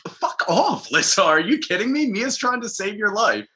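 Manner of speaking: high-pitched exasperated outburst; mock indignant retort. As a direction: tell someone off in playful outrage. Acted indignant retort to Lissa.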